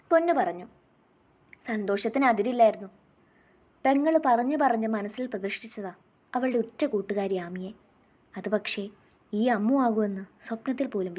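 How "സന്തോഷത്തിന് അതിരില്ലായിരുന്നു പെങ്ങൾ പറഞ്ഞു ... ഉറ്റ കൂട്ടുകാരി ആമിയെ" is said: usual speaking voice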